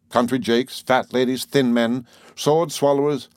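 usual speaking voice

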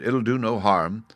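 plain reading voice

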